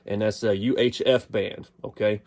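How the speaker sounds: normal talking voice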